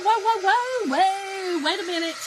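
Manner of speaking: loud, high startled stop, laughing; startled whoa. Retold or imitated. To the cable guy at the door, a startled laughing whoa. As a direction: hold on, half laughing. Speaker retells her startled reaction.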